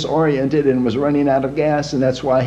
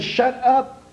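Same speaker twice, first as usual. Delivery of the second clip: much higher, irritated order; husband silencing his wife; irritated snap; 10 semitones higher than usual. Acted. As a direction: cut her off sharply.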